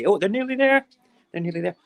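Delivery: high, loud burst of excitement; light up as they arrive; excited. Real, not acted. Excited as bin men arrive.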